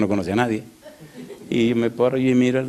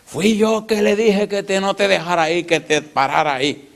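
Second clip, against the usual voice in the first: proud gossip; 8 semitones higher, 3 dB louder than usual. Retold or imitated. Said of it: "el chismoso mayor ... le dice".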